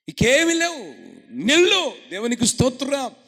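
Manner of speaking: loud, high preacher's cry; preaching shout. Acted. Preacher crying out praise to the congregation.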